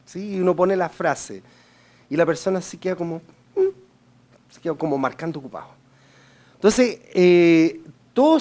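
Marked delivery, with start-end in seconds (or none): 3.5-4.5 high, drawn-out hesitant hum; thinking hum. Retold or imitated. Customer stalling on the line with a high hum. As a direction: hum like someone who is still thinking. Caller stays thinking, no answer.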